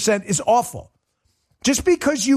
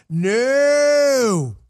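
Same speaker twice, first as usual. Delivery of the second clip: emphatic no; 4 semitones higher than usual. Real, not acted. Host answering the chat about prices, shouted no. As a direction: slam the answer down.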